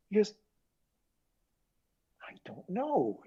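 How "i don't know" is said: high, breath-only whisper; awed whisper. Retold or imitated. Artist reacting to the mix, retold in a whisper. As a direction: imitate someone hushed and lost for words.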